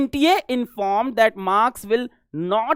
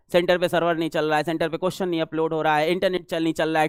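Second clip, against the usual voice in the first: rattled-off list; 6 semitones lower, 5 dB quieter, faster than usual. Retold or imitated. Rapidly listing what went wrong there.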